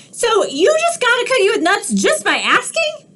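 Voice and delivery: high, quick cartoon pigeon demanding answers; a nosy, pushy bird; squawky pigeon grilling the duckling.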